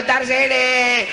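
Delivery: high, slow, stretched-out call; hammed-up dying wail; acted drawn-out cry. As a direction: drag the cry out high and long. Acts out cry at death's visit.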